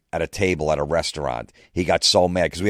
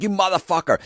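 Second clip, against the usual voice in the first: high-pitched burst of outrage; outraged cursing; 13 semitones higher, faster than usual. Retold or imitated. Boss learning of the Bengay prank, high outraged cursing. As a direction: curse him out, voice shooting up high.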